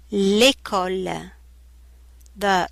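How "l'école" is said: "l'école" drawn out slowly, syllable by syllable; slow textbook pronunciation. Shown as a demonstration.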